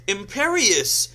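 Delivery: loud, high, drawn-out incantation; shouted spell. A narrator's reading. Voldemort casting on the heroine, shouted spell. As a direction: hurl the spell out at full voice. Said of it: "Voldemort also shouted".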